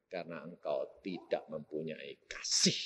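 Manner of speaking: soft whispered verdict; preacher whispering the reason to the congregation. Acted. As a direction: breathe the words to the hearts.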